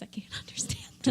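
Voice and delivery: laughing whispered confession. Real, not acted. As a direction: whisper it like a guilty secret.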